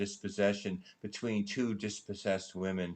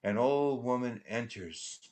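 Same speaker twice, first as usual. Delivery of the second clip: ordinary delivery, nothing marked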